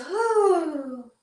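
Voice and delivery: high whooping cheer; relaying a spirit's cheer, high and gleeful; excited whoo. Retold or imitated. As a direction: let out a joyful high whoo.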